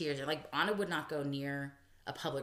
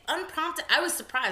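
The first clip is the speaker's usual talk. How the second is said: usual speaking voice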